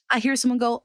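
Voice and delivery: ordinary talk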